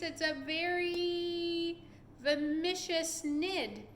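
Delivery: slow, drawn-out spooky voice; dramatic dread. A narrator's reading. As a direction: stretch every word with horror. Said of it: "cried the chief of police".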